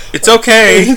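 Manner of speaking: mock pleading it's okay; reassuring mom. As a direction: kid talking mom out of switching it off. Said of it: "I went".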